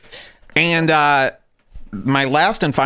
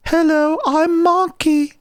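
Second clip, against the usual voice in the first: put-on very high, slow baby talk; Marky's baby voice; slower than usual. Imitating the kid he babysat, high baby voice. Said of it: "Marky had baby voice".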